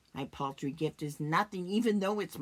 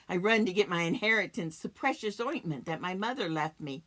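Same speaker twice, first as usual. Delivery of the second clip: normal talking voice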